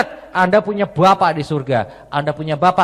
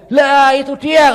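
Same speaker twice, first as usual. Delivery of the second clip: mother's eager voice; 9 semitones higher, 8 dB louder, slower than usual. Retold or imitated.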